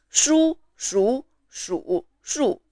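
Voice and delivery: shu repeated, each on a different tone; run through the tones. Shown as a demonstration. Teacher introducing another example, shu in contrasting tones. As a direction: give every shu its own pitch shape, spaced out. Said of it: "The first is the tones".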